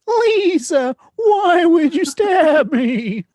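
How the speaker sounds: very high, slow bleating squeak; lamb voice. Pretend. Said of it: "it was like".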